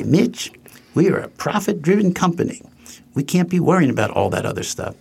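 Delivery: mock the boss's accent as he lectures; imitating the CEO's accent. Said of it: "in his horrible accent".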